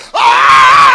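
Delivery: loud full-throat cry; preacher's ecstatic shout. Real, not acted. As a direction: let the holler go. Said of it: "I'm about to let it get away from me".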